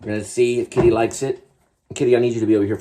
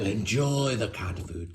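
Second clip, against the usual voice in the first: soft ad read; 8 dB quieter, much slower than usual. Pretend.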